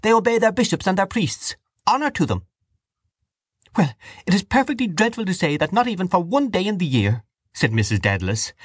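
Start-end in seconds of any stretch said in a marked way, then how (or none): 0.0-2.4 very high, righteous declaration; high, defiant defence; crying out. A narrator's reading. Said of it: "cried Dante". 3.8-7.2 high, plaintive complaint; dismayed, high-pitched reproach; distressed wife character. Acted.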